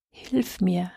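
soft unvoiced whisper for help; whispered plea. A narrator's reading. Boy in a desert storm begging the shepherd, whispering. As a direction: breathe the words out, scared. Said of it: "flüsterte Chaim".

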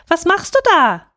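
put-on high, loud childish call; boy calling up. A narrator's reading. Boy hails the rooster on the tower, calling up. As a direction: call up to someone high above. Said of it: "rief der kleine Häwelmann hinauf".

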